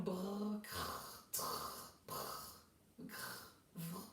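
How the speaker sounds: "brr, crr, trr" as drawn-out raspy French r sounds, no vowel; isolated consonant blends. Shown as a demonstration. Teacher recalls yesterday's sounds to pupils, isolated drawn-out blends. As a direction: sustain the r in each of "brr, crr, vrr", softly. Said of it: "les sons que vous avez vus".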